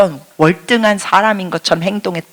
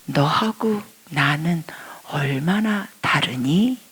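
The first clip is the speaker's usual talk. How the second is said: soft, slow whispered question; God's kind whisper; gentle whisper; 2 semitones lower, 6 dB quieter, much slower than usual. Retold or imitated. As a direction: ask it tenderly, barely voiced. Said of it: "너무너무 친절하게 말씀하십니다".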